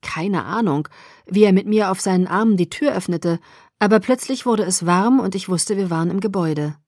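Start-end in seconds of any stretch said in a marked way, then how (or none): none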